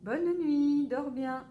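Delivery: papa calling a cheery goodnight from the door; papa's goodnight. A narrator's reading. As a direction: tuck him in cheerfully, voice lifted. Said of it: "il dit".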